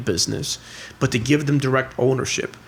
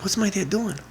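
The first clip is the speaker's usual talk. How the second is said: put-on high, excited young boy complaining; a boy puzzled by his father; kid's voice; 9 semitones higher than usual. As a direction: play the baffled son, pitched up. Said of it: "He's like".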